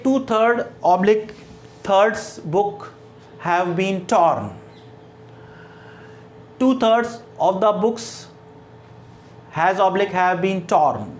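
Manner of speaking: very slow, word by word reading; slow classroom dictation pace. Shown as a demonstration. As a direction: read the sentence out slowly for dictation. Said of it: "teacher reads example sentence slowly".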